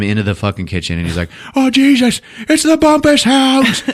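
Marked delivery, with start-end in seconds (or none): 1.6-3.8 high, loud mock panic; panicking dad. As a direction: holler in horror as dad. Dad sees dogs racing to kitchen.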